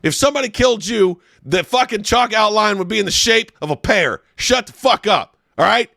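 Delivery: high, loud mock-furious tirade; mock rant. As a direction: tear into your friend in a hammed-up rage. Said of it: "That was great".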